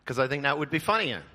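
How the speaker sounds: normal talking voice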